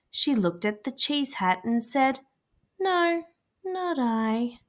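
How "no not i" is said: put-on higher, slow small-girl refusal; a shy girl shrinking back; timid little girl. A narrator's reading. Rosie looks at the cheese hat and refuses, timid and slow.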